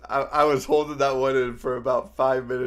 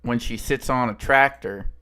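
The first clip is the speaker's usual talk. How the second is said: louder, hammed-up Texan accent; 7 dB louder than usual. Streamer's friend demands the Texan accent, loud mock Texan. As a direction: belt the yo mama joke like a Texan. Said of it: "I was doing the, the accent".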